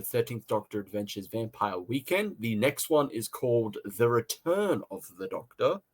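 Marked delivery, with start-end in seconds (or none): none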